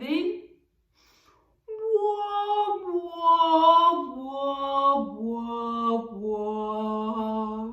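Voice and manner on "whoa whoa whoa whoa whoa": ocean fish breath. Shown as a demonstration. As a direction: let each whoa fall step by step. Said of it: "ocean fish breath".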